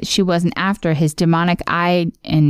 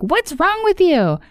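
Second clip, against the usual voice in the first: high indignant yell; 11 semitones higher than usual. Retold or imitated. Finn furious at Ice King.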